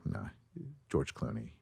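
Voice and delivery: very low, soft murmur; hushed low register; low pitch. Shown as a demonstration. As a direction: drop deep and quiet to compare voices. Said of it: "It's lower. It's probably down".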